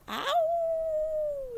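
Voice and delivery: long, high howling wail; dog howl. A narrator's reading. The dog wails in the church, high drawn-out howl. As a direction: let out a dog's high howl, held long. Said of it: "said Winn-Dixie".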